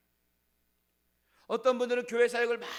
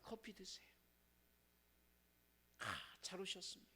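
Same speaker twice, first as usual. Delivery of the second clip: much softer, gentle greeting; kind welcome; 2 semitones lower, 20 dB quieter than usual. Retold or imitated. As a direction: welcome a visitor warmly. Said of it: "그냥 한마디 해줍니다".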